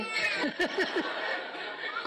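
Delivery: airy, high, embarrassed chuckle; sheepish laugh. Real, not acted. Preacher laughing at himself for losing the thread, sheepish chuckle. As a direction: laugh at yourself for rambling.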